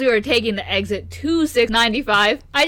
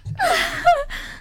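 high-pitched burst of giggles; squeaky high giggle. Real, not acted. Laughs at friend on mic.

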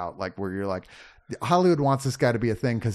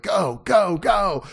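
retold race-crowd cheer, raised voice; excited cheering; 6 semitones higher, 5 dB louder, much slower than usual. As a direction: urge them on, higher and louder. Acted cheering during race scenes.

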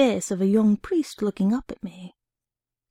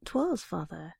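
respectful; 3 semitones lower, 8 dB quieter than usual. A narrator's reading. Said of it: "he said respectfully".

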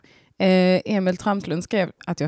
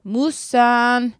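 lightly voiced child, a bit louder; child's plea; 4 semitones higher, 6 dB louder, much slower than usual. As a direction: whine like a kid embarrassed by mum. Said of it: "hade han sagt". (lätt barnröst som ber mamma sluta)

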